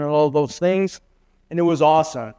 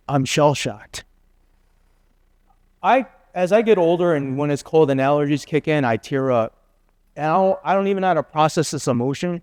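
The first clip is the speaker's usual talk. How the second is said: usual speaking voice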